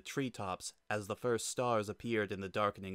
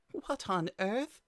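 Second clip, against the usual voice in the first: puzzled; 11 semitones higher, slower than usual. Acted. Toriel puzzled by hedge.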